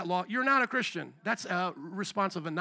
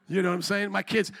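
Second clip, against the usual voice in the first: ordinary talk, nothing marked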